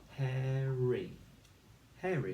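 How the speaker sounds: ordinary talk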